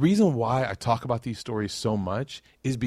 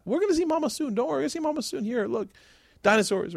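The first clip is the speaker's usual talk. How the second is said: much higher, gentle soothing voice; calm a crying toddler; high sing-song reassurance to a scared son; soothing a child; faster than usual. Retold or imitated.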